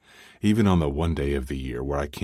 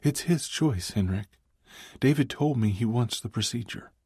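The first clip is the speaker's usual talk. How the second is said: lightly shaky female voice; a hint of tears in her voice; wobbly voice; 8 semitones higher than usual. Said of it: "she said with a wobbly voice".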